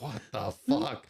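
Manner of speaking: slow disbelief. Retold or imitated. Soldier hearing they must clear the village, slow disbelief. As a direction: stretch it out in disbelief. Reacting to new orders.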